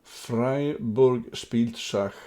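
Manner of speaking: slow, loud, carefully spoken German; slow German phrase. Shown as a demonstration. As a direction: spell it out slowly in German. German phrase dropped among English.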